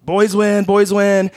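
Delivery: high, sustained mock call-out; retold yelled announcement. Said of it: "and I yell out".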